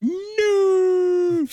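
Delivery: high drawn-out wailing no; mock wail. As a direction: cry it out like a tragedy.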